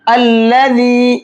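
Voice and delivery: slow chant of अल्लज़ी opening on a clear hamza with a; starting with hamza. Shown as a demonstration. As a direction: begin अल्लज़ी with a fresh hamza. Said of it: "फिर पढ़ेंगे हमज़ा लाम ज़बर अल".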